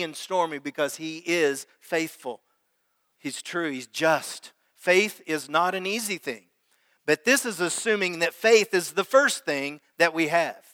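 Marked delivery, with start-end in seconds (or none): none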